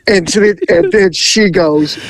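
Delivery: ordinary delivery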